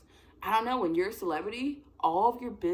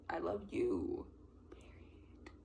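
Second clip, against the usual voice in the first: hushed pretend confession; very soft, voiceless adoration; starstruck whisper; 5 semitones lower, 9 dB quieter, much slower than usual. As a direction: whisper it like an awed fan. Acting a starstruck fan.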